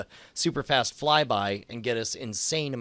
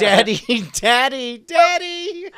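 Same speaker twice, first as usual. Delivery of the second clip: put-on much higher, louder, drawn-out child wail; whiny child probe; 12 semitones higher, 10 dB louder, slower than usual. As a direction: wail for daddy like an abandoned probe. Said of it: "It's like".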